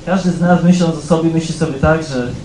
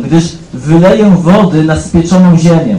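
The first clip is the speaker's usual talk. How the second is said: "wyleję wody na spieczoną ziemię" said louder and slow, proclaimed; proclaiming scripture; 6 dB louder than usual. Acted. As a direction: stretch out "spieczoną ziemię" as a grand promise. Proclaimed reading of God's promise.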